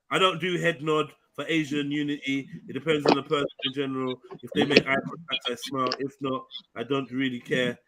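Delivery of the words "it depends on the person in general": ordinary talk, nothing marked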